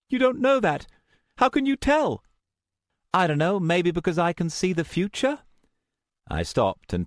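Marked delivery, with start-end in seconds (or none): 0.1-2.2 high, louder challenge; high-pitched indignant disbelief; challenging. Acted. Luna disputes the narrator's assurance. 3.1-5.4 high-pitched sarcastic retort; exasperated sarcasm, pitched up; snarky. A narrator's reading. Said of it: "Being snarky wasn't going to help".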